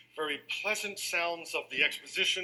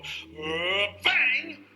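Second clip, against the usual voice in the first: mock sudden bang; 3 semitones higher, 4 dB louder than usual. Shown as a demonstration. Teacher shows an orchestra's sudden loud outburst. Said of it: "They can't go".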